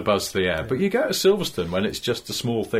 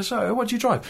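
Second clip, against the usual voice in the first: high, lilting party-guest question; posh party guest voice; 11 semitones higher than usual. Retold or imitated. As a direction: ask it like a snooty guest. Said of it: "they're going".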